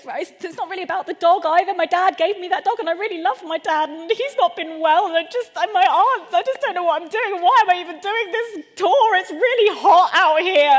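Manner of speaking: hammed-up hysterical whine; tearful meltdown. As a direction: lose it while explaining, building to a loud wail. Apology builds into acted meltdown.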